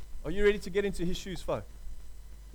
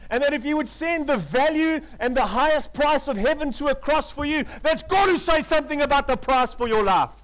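louder, higher, pressing preaching; full-force preaching; 5 semitones higher, 5 dB louder than usual. Acted. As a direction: drive the love of God home to the crowd.

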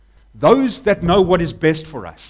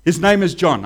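firm, louder no; emphatic refusal; 5 dB louder than usual. Retold or imitated. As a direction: put her foot down on no. Elizabeth's firm refusal quoted.

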